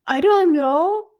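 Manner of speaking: put-on sulky small child voice, drawn out; girl answering the dad who asks how long, high and pouty; little girl.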